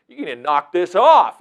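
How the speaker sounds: stern sheriff. Retold or imitated. Sheriff warning kids.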